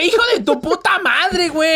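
high, laughing yell at the TV. Retold or imitated. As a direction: scream at the screen between laughs. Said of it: "Así moviendo la pantalla".

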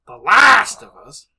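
booming, drawn-out title call; quoting podcast friend. Retold or imitated. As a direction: blast out the title in a friend's exaggerated style. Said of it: "quote one of our good podcasting friends".

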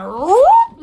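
high, loud rising whoop; imitated whoop. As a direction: let out a sudden comic whoop. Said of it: "my pussy was like".

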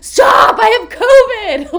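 loud high laughing yell; mock scream. Driver honking at her, she yells back laughing. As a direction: scream it at the drivers half joking. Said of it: "I'll be like".

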